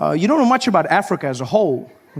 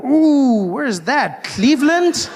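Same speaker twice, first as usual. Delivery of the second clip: high, wincing ooh into a question; recoil, then ask what awful place this is; retold appalled reaction; mock shock; 7 semitones higher, slower than usual. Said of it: "I'm like".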